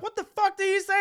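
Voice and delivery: high-pitched indignant impression; imitating shocked girl. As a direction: squeal it as an appalled guest.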